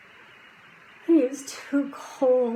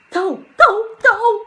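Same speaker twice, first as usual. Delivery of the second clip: very loud, high repeated yell; shouting; 13 semitones higher, 11 dB louder than usual. A narrator's reading. Jack driving the wolf away, very loud high shout. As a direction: bellow it to drive the wolf back. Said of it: "shouted Jack".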